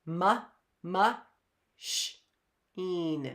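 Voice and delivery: m, sh, een said slowly apart; slow sound-by-sound machine. Shown as a demonstration.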